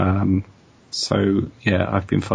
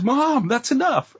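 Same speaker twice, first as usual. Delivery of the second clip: exasperated son; slower than usual. Retold or imitated. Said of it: "he goes".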